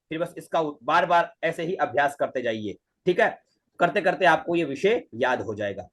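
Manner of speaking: ordinary talk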